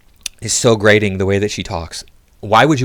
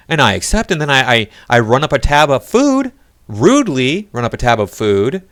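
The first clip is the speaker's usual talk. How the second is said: louder, higher sarcastic rant in her place; sarcastic mock outrage; 6 semitones higher, 5 dB louder than usual. Ranting as the woman about her date leaving, louder and higher in mock outrage. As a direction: play her indignation as a hammed-up joke.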